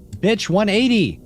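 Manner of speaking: sudden high yell of frustration; frustrated yell. Real, not acted. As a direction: shout it in exasperation.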